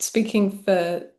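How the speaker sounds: ordinary talk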